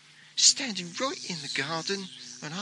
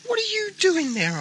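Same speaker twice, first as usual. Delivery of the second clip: high, louder startled question; much higher startled demand; 4 dB louder than usual. Acted. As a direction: call out in surprise. Finds cow at back door.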